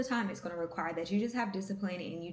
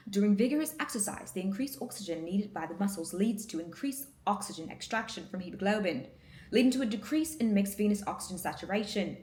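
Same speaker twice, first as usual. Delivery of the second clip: put-on British news-lady voice, pitched a little up; British accent; 3 semitones higher than usual. Student drilling an exam explanation into memory, playful British accent. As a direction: read it out like a British news anchor. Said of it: "I read it in a British accent".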